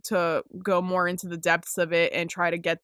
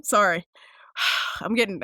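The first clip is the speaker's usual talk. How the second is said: ordinary talk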